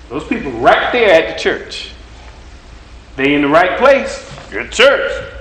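rhythmic, call-and-response sermon delivery; preaching. Acted. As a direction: preach it to the congregation.

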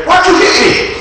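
high, loud outraged cry; high loud demand of a wronged partner; indignant accusation. Retold or imitated. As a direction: shout it, wronged and confused. Victim accusing in illustration.

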